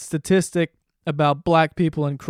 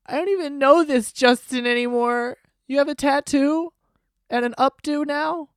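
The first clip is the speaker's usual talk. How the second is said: much higher imitation of dismayed fans; mock upset fans; 10 semitones higher, 4 dB louder than usual. Host imitating everyone after [name]'s haircut, mock dismay. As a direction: wail it high in mock disbelief.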